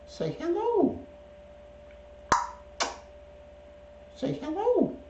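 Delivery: very high, sing-song coo; pet-coaxing voice. Shown as a demonstration. Owner prompting his pet bird to say hello, high coaxing voice.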